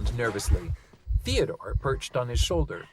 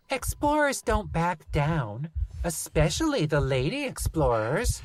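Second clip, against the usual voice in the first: put-on child voice; 6 semitones higher than usual. Lucy child voice.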